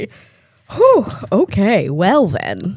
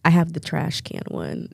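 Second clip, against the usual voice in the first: soft, low mutter to self; 4 semitones lower, 14 dB quieter than usual. Real, not acted. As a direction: talk to yourself under your breath. Muttering while searching phone.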